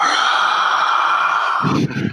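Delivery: long breathy roar of a crowd. Acted. Game master voicing the arena crowd, drawn-out hushed roar. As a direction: imitate a cheering crowd with breath. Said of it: "it's all like".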